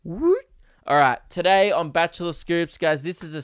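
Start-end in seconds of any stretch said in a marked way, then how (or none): 0.0-0.5 high squeaky woot; a quick celebratory yelp; little cheer. Acted. Podcaster cheering his own intro, high yelp.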